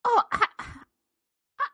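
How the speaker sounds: put-on high, slow, faltering old lady voice; flustered old woman. Old woman to the reverend, flustered and faltering. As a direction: stammer it out like a timid old woman.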